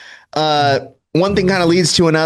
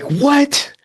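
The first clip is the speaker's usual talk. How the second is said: much higher, startled dad's "What?"; incredulous dad; 14 semitones higher than usual. Retold or imitated. Son retells dad answering, in a high startled voice. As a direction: play the dad jolted awake. Dad woken at 3am, retold.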